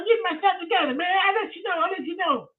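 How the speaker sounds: high squeaky voice for the caller; [name]'s voice. Retold or imitated. Retelling the strange caller, high mimicking voice.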